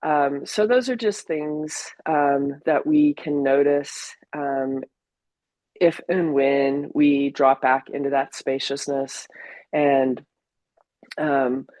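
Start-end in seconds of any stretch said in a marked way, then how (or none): none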